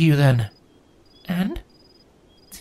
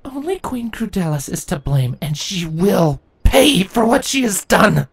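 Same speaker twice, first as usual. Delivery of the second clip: higher, building to a slow firm threat; bitter vow; 11 semitones higher than usual. A narrator's reading. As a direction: grit it out as a promise. Twilight vows revenge.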